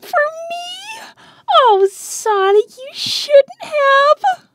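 touched gushing. Acted. Amy moved by flowers.

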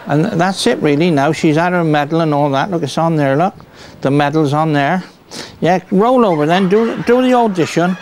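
broad Somerset farmhand voice, kept up all through; Somerset accent. Acted. Farmer showing off his dog to the camera, in a broad Somerset accent. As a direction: play a rustic Somerset yokel who's chatting away. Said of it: "See if I got the accent again".

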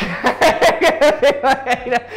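high, loud burst of laughter; real cracking-up laugh; big real laugh. Real, not acted. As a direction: burst out laughing at your own botched line. Laughs at own botched line.

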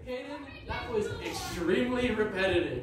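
exasperated complaint. Real, not acted. Friend complains fencer repeated moves.

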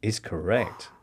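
low, soft quizmaster ruling; understated confirmation. Real, not acted. Quizmaster confirms answer on Bayern and Barcelona, understated and soft. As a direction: drop it down quietly, almost deadpan.

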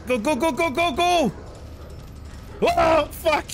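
high panicked shouting; frantic yelling to go; panicking gamer. Real, not acted. Chased by dogs.

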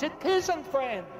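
ordinary delivery

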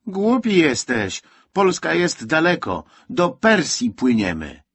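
loud, high, slow put-down; scolding. A narrator's reading. Boy corrects little one about Persia, loud scolding. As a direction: hammer it home to a silly child.

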